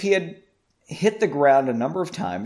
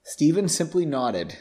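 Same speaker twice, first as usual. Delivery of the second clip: ordinary delivery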